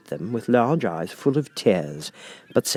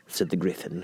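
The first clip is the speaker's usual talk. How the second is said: plain reading voice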